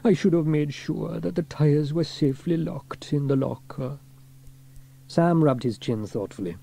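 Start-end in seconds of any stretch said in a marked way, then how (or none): 0.0-4.0 mildly moaned self-blame; a touch of sorry whining; moaning regret. A narrator's reading. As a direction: lament your own mistake. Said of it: "moaned Elvis".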